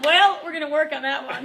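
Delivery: answering Cordy with a big laugh. Real, not acted. Laugh it out loud.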